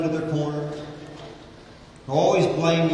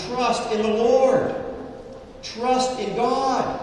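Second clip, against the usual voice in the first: emphatic preaching; 8 semitones higher, slower than usual. Real, not acted.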